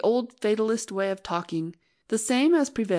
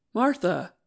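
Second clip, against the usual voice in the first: gently reproachful. Acted. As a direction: chide her lightly by name. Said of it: "said Arno reproachfully".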